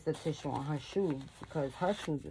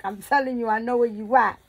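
loud, higher retold threat; imitated threatening son; 4 semitones higher, 9 dB louder than usual. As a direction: mimic a son's menacing warning at volume. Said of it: "my son was threatening the man".

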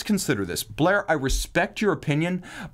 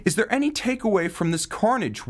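ordinary delivery

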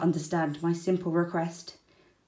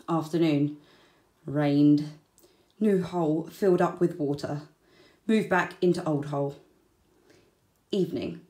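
usual speaking voice

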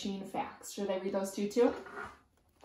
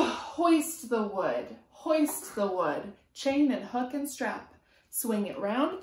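lively sing-song picture book reading; reading to children; 2 semitones higher, 3 dB louder, slower than usual. A narrator's reading. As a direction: chant the rhyme to a small child. Reading rhyming picture book aloud.

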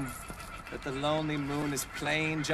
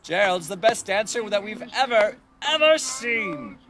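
much higher and louder, crowd in awe; amazed shout; 9 semitones higher, 6 dB louder than usual. Acted. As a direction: cheer it like a stunned crowd. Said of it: "They shouted".

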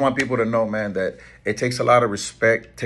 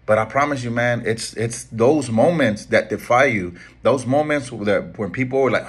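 ordinary delivery

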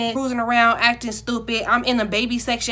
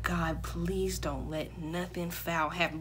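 slow, hushed anxious plea; anxious prayer; 6 semitones lower, 11 dB quieter, slower than usual. Retold or imitated. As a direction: pray under your breath, low and slow. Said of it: "I'm just anxious".

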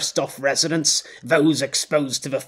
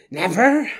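Never pushed higher with a rising end; 7 semitones higher, 4 dB louder than usual. Acted. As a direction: spit out Never, going up. Repeated vow building.

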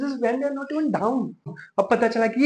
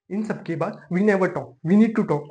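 ordinary talk

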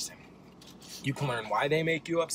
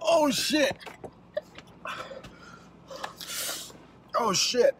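pained exclaim; 10 semitones higher, slower than usual. Real, not acted. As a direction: cry out, choking on the spice. Coughing after heat.

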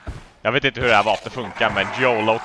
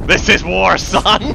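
loud, raised mock battle cry; 5 semitones higher, 5 dB louder than usual. Real, not acted. Gamer hamming up a fight, loud mock battle cry. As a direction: declare war with a grin.

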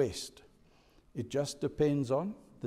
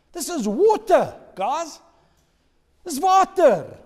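rising, louder amazement at the water; amazed; 11 semitones higher, 8 dB louder than usual. Real, not acted. Preacher telling the congregation in awe about water, excited. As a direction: marvel at it, building.

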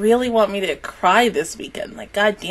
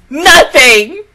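"Nothing!" shouted high and loud; emphatic; 4 semitones higher, 10 dB louder than usual. Real, not acted. To viewers admitting no theory, loud and emphatic. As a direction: slam the word "Nothing!" home.

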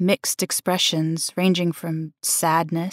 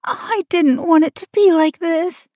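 whimpering; 10 semitones higher than usual. A narrator's reading. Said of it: "she whimpered".

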